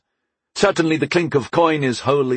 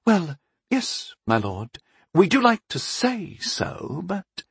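high-pitched, slow hedging; drawn-out, reluctant high voice; flustered abbess; 9 semitones higher, slower than usual. A narrator's reading. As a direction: agree reluctantly, trailing off into a doubt. Flustered abbess hedges before noble.

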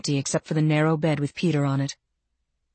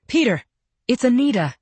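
calling out; 7 semitones higher than usual. A narrator's reading. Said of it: "and he screamed".

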